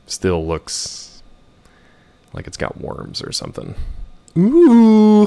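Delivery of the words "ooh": high, loud cooing ooh; excited ooh. Real, not acted. Player spotting Cleve, cooing high and loud. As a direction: coo with delight.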